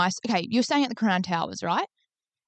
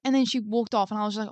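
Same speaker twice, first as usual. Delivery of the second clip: ordinary talk, nothing marked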